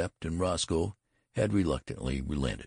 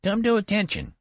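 loud, very high treble command; attempted thunder; 6 dB louder than usual. A narrator's reading. Boy general orders sentry to attention, piping attempted thunder. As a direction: try to thunder but come out piping. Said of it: "he tried to thunder".

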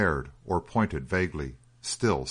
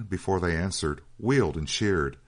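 ordinary delivery, nothing marked

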